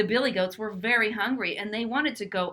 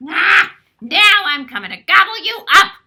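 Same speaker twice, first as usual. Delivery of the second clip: troll roar; 10 semitones higher, 16 dB louder than usual. Acted. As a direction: roar it out like an angry troll under the bridge. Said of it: "roared the troll".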